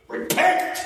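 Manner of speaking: high, sudden 'repent' outburst; exaggerated high-pitched command; mock stern rebuke. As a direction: jokingly preach it at a small child.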